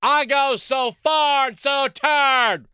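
slow, high mock drawl of Hillary.

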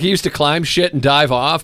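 usual speaking voice

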